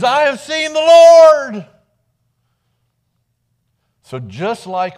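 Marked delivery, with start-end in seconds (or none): 0.0-1.7 high, loud, slow cry of joy; a shout of joyful news; overjoyed. A narrator's reading. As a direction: announce it, bursting with joy. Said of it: "went to the disciples with the news".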